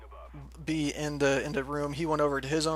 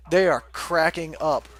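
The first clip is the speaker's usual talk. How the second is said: ordinary talk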